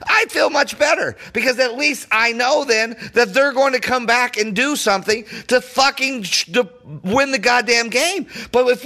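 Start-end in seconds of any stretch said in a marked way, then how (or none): none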